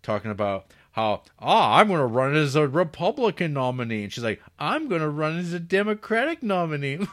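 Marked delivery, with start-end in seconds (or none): 1.4-4.0 loud, higher-pitched hammed-up declaration; mock proclamation. Husband declaring his candidacy to his wife, mock proclamation. Acting husband's boastful nominee announcement. 4.6-7.0 high-pitched mocking declaration; mock retort. Wife countering her husband's candidacy, high mock retort.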